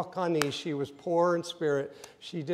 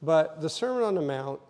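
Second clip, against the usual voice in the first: ordinary talk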